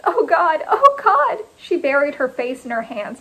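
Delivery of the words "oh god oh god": high wail, climbing higher and louder; distraught gasp rising to a wail; gasping distress. A narrator's reading. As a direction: cry out, falling apart. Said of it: "she gasped".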